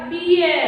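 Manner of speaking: "बियर" modelled with an E sound, A left silent. Shown as a demonstration. Teacher shows students the E sound, "बियर" with silent A. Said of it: "ए इसमें silent रहता है".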